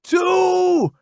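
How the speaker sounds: very high yell; shout. Acted. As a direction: call it as he runs. Counting before running out.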